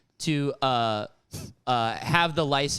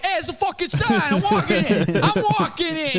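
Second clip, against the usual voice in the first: high, loud put-on shout; mock New York yell; 13 semitones higher, 5 dB louder than usual. Pedestrian yells back at the cab driver, loud mock New Yorker.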